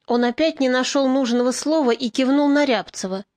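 plain reading voice

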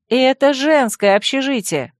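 indignant. A narrator's reading. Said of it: "возмутилась я".

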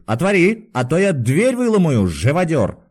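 threatening cry, high then dropping; angry shout. A narrator's reading. Patient threatens to break down the door, shouting. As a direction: threaten him through the locked door. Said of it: "крикнул Иван Дмитрич и постучал кулаком".

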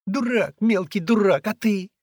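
put-on very high, loud girl's retort; exasperated scolding. A narrator's reading. Girl berating the boy below, exasperated.

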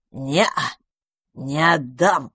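insolent teasing refusal; cheeky smirk. A narrator's reading. Magpie keeps her catch, insolent and smug. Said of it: "нахально усмехнулась сорока".

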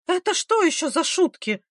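high-pitched outrage without much volume; furious cry. A narrator's reading. Mother outraged at ice in the bundle, furious cry. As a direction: demand it in shrieky, high indignation. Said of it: "закричала женщина в ярости".

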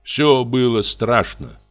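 ordinary delivery

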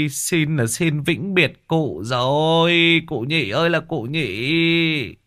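put-on high, wailing old drunk mourning his mate; hammed-up funeral wail, slowing at the end; mock lament. Thọt laments friend's doom.

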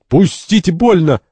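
high, loud yelp of pain; crying out. Acted. Santori pinned by three men, crying out. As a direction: yell to be let go. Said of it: "Сантори кричит".

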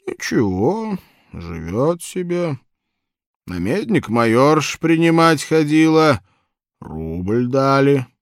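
put-on high, loud chatty old man. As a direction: ramble like a cheerful old man.

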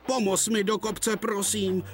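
high, begging plea for help; pleading. Acted. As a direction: beg him, exhausted. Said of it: "prosil James". (prosebně, vysokým hlasem)

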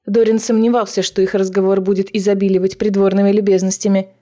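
usual speaking voice

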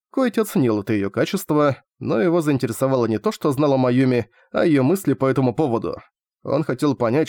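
ordinary delivery, nothing marked

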